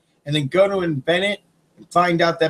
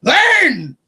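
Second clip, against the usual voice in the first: high, loud one-word holler; sharp high-pitched shout; exasperated command; 11 semitones higher, 5 dB louder than usual. Real, not acted. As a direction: yell it as the obvious answer. Shouted answer to mocked people.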